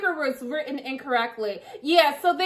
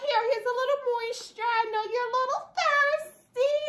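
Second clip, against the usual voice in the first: coaxing sing-song; 9 semitones higher, 5 dB quieter than usual. Pretend. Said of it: "So I'm like".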